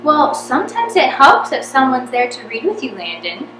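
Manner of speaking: loud, sly mock helpfulness; Eric's sly needling. A narrator's reading. Eric slyly urging Landon to rehearse with Jamie, loud. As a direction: stick it to him with a grin.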